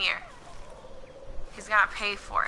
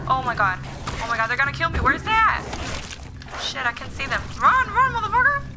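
being chased; 8 semitones higher, 4 dB louder than usual. Real, not acted. Chased by a mob in game.

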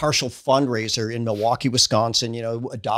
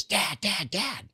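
a lightly voiced son calling Dad, Dad, Dad, pitched higher and eager; excited son; 7 semitones higher, 7 dB quieter, much slower than usual. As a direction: imitate the son's eager calling, higher and quieter. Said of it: "I could tell he's very excited".

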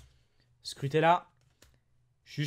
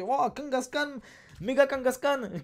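lightly imitated English-speaking commentator, higher pitch; American match commentator; 5 semitones higher, slower than usual. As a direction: mimic an amazed commentator, stretching "Méga Kangaskhan". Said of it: "Le mec, il commentait".

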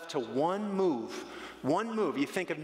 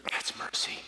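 whispered. Real, not acted. Answers his own question.

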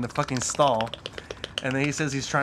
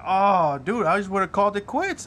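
disgusted reaction; 6 semitones higher, 5 dB louder than usual. Retold or imitated.

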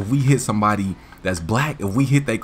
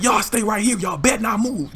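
stern warning voice; 10 semitones higher than usual. Retold or imitated. Driver warning.

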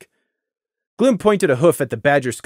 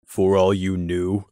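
low, slow, flat disbelief; deadpan, but let disbelief show; flat incredulous; 6 semitones lower, slower than usual. Acted. Crimson echoing Scenic flatly, incredulous. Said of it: "flat pronouncement sound incredulous".

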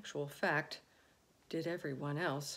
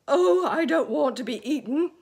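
high, loud wail; 8 semitones higher, 17 dB louder than usual. A narrator's reading. Earthworm wailing about being eaten. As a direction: wail in terror. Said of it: "wailed the earthworm".

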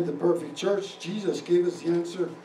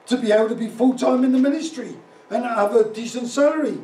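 loud, raised preacher's voice; forceful preaching; 5 semitones higher, 8 dB louder than usual. Real, not acted. Pastor tells congregation of turned-down churches, preaching at full force. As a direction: project it hard to the whole congregation.

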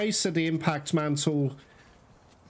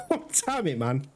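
laughing curse. Real, not acted. Player laughing at his bad luck, fast laughing curse.

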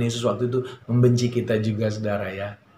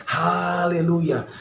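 drawn-out hallelujah; 6 semitones higher, much slower than usual. Real, not acted. Preacher exclaims praise.

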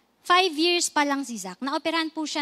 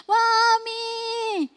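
higher, louder wailing cry for mommy, her best attempt; child crying for mommy; 9 semitones higher, 4 dB louder than usual. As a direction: give the fullest version of a hurt child's desperate call. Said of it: "yun na feeling ko yung pinaka-best na makakaya ko". (mas mataas at mas malakas na iyak na "Mommy!")

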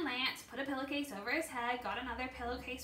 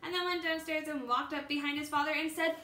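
plain reading voice

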